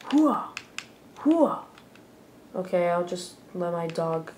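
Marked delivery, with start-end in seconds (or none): 0.1-1.6 high loud hooah war cry; loud gung-ho soldier. Acted. Battle cry while fighting.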